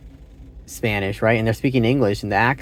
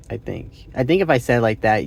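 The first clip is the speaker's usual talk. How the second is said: ordinary talk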